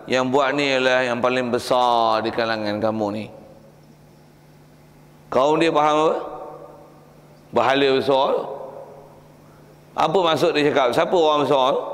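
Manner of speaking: ordinary delivery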